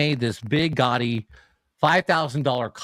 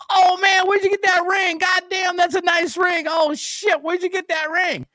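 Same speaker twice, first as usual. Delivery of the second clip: much higher, shouting mock street guy; excited stranger. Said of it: "and he goes".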